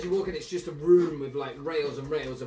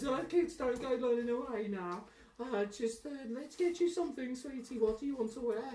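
put-on high, softer Scouse voice to a child; scouser accent; 9 semitones higher, 5 dB quieter than usual. Dresser coaxing a child in high soft Scouse. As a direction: coax the kid in a high Liverpool lilt. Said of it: "he goes".

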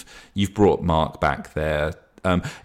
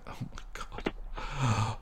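sobbing; 4 semitones higher, 11 dB quieter, much slower than usual. Acted. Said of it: "and he's sobbing".

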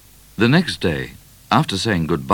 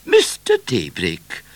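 a measured, slow royal address; name him slowly as the queen; regal address; 2 semitones higher, slower than usual. A narrator's reading. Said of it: "Queen addresses him".

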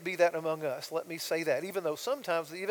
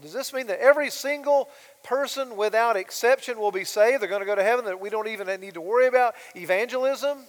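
louder, higher rhetorical questions; emphatic preaching; 4 semitones higher, 10 dB louder than usual. Acted. Preacher posing challenging questions to the congregation, loud. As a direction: challenge the room.

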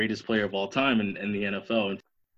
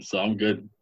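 normal talking voice

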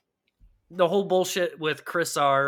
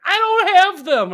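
very high, loud retold outburst; mock desperate yell; 10 dB louder than usual. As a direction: imitate someone yelling back at breaking point. Retold quarrel reaches its peak.

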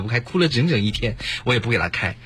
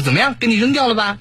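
taunting; 7 semitones higher than usual. Retold or imitated. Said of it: "我说".

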